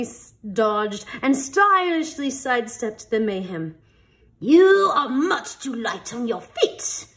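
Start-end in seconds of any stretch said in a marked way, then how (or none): 4.4-7.1 slow, loud, higher scold with a laugh; scolding headmaster. Acted. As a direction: tell him off, half amused. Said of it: "the headmaster scolded".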